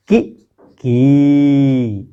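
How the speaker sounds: कि short, की long and held; की stretched from the palate; short and long i demo. Shown as a demonstration. Teacher contrasts the vowels for pupils, short then long. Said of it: "तालु".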